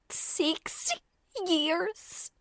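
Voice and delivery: high, whispered faltering answer; timid whisper. Acted. Frightened girl whispers her answer to the enforcer. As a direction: trip over foreign words softly.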